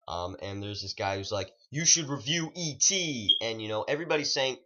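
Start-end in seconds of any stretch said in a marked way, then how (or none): 1.7-3.4 high, loud, stretched-out urging; insistent fan. Retold or imitated. Guy in the trailer urging a review, imitated insistent call. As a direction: imitate someone pressing a request, loudly drawn out.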